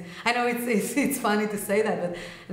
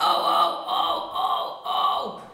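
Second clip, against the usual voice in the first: toneless dark growls; noisy repeated metal growl; pitchless distorted "oh"; slower than usual. Shown as a demonstration. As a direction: take the pitch off, keep the noise. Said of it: "I would take the pitch off".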